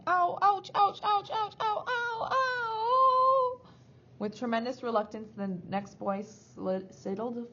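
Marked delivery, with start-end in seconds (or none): 0.1-3.6 string of high yelps rising into a wail; escalating cries of pain; pained yelling. A narrator's reading. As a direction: yelp faster in agony, ending in a long howl.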